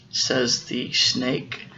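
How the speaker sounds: ordinary delivery, nothing marked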